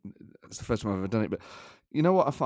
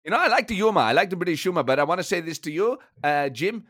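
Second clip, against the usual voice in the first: irritated German manager impression; comic, indignant football boss; Thomas Frank. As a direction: bristle at the interviewer in German-accented English. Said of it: "Thomas Frank, it's been a pleasure".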